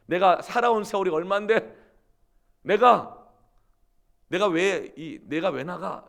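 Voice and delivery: loud indignant start easing toward the end; indignant wife, fading. Retold or imitated. Wife protesting, indignation fading out. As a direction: sputter with outrage, then run out of steam. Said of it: "그랬더니 부인이".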